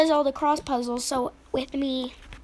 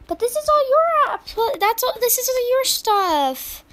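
high, loud, slowing exclamation; high excited voice, slowing at the end; high excited mom; 10 semitones higher, 6 dB louder than usual. Pretend. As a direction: gush over the pile. Mom voice exclaiming over stuff.

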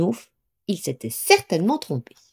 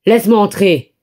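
louder furious demand; very angry; 2 semitones higher, 8 dB louder than usual. A narrator's reading. The wolf at the brick house door, furious. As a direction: bang out the demand in anger. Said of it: "très en colère".

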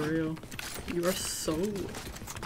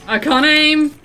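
panicked yell; 8 semitones higher, 11 dB louder than usual. Real, not acted. Panicking in a firefight.

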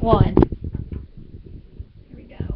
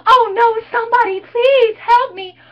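higher fake plea, slowed on please help me; mock panic; 12 semitones higher, 4 dB louder than usual. Cheerleader fakes panic to taunt the killer. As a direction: overact the helpless victim. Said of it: "I'm panicking now".

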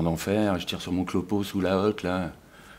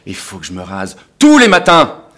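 loud burst of indignation at the end; exasperated outburst; 14 dB louder than usual. Acted. Man fed up with shaving every morning, exasperated outburst. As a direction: build to a shout on the last words.

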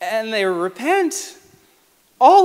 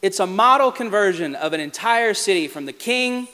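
normal talking voice

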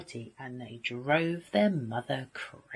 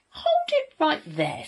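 high, indignant wolf; firm interruption; 13 semitones higher, 4 dB louder than usual. A narrator's reading. Wolf stopping the pig's threat, firm interruption. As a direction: cut in sharply, pitched up.

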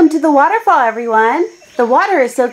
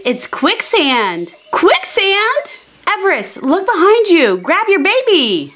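ordinary talk

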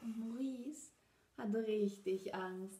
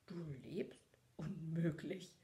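exclaimed in disbelief; 4 semitones lower than usual. A narrator's reading. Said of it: "rief Maurice aus".

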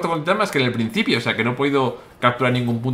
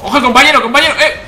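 shouting a sudden warning; alarmed shout; 9 semitones higher, 8 dB louder than usual. Real, not acted. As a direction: yell it out in alarm. Warning teammate mid-fight.

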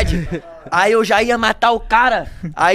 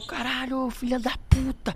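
restrained mock rage; 3 semitones higher, 10 dB quieter, slower than usual. Acted cursing while being held back.

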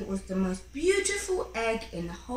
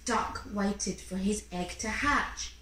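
ordinary delivery

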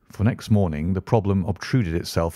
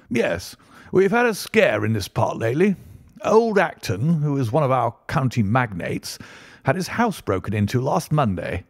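higher-pitched, animated character voice for the Colonel; genial country Colonel; 5 semitones higher than usual. Acted. Colonel tells his guests of a local break-in, chatty and higher. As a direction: swing the pitch up as you share local news.

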